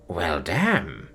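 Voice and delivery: soft low purr. Acted. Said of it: "she purred".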